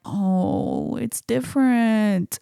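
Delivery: drawn-out, slow lament; hammed-up dismay, stretching every word; mock dismay. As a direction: play up the letdown slowly. Acts out old dismayed reaction.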